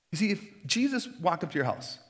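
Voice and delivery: normal talking voice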